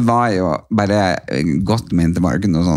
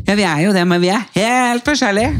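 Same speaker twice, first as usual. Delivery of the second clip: imitated friend; 12 semitones higher than usual. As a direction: stretch it out high, mocking her insistence. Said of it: "Og så var hun sånn". (høy, langtrukken etterligning av venninna)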